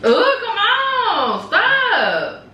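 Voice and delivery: high, slow, laughing plea; laughing protest. Real, not acted. Reacting to the burping.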